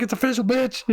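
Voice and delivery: high-pitched mock triumphant boast; in-her-face gloating. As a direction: imitate a man rubbing it in at his girlfriend. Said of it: "got in her face".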